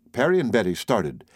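plain reading voice

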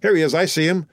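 high-pitched call of discovery; excited cry. A narrator's reading. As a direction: alert the others urgently. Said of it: "cried a voice from the landing".

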